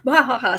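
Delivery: hammed-up evil bah ha ha; a mock villain cackle; sinister laugh. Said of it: "sinister laugh".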